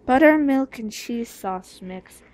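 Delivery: ordinary talk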